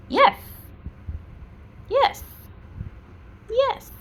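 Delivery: very high, loud "yes" to a pet; praise for dog. Real, not acted. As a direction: reward the dog with a high-pitched marker word.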